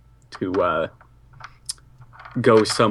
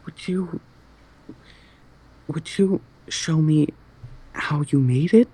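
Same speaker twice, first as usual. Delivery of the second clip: put-on higher, sweet girlish voice; cute anime girl; 5 semitones higher than usual.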